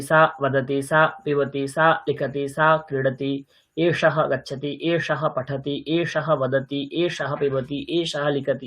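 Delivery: steady, monotone chant-like rhythm; sentence-drill recitation. Shown as a demonstration. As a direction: recite each pair evenly on one pitch.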